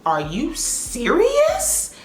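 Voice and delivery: slow, drawn-out high disbelief; incredulous. Retold or imitated. Stylist appalled at her client, slow incredulous voice. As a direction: stretch it out, appalled.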